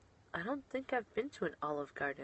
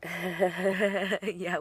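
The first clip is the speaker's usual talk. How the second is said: usual speaking voice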